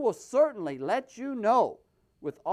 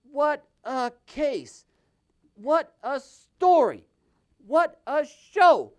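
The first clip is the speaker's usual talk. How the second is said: each "what a" louder than the last; building exclamation; 2 semitones higher, 8 dB louder than usual. Real, not acted. Host marvels at the case, louder and louder. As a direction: build from wry to loud delight.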